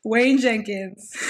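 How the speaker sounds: loud, drawn-out bad impression; overdone, slow mock cop-show voice; Jon Bernthal impression. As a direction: stretch the name out loudly in a hammy impression. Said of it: "a really bad impression of him".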